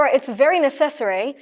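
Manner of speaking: slow, easy American accent; demonstrating an American accent. Shown as a demonstration. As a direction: put on an American voice, drawn out. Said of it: "American accent में बोल रहे हो".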